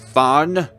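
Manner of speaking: loud, high disbelief; outraged shout. Acted. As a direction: shout it back in horror. Horrified outrage.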